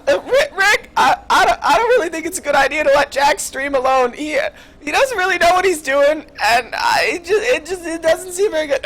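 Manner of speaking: high, stammering nervous Morty. Acted. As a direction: stutter it out anxiously, voice up high. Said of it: "I'm gonna start doing a Rick and Morty impression now".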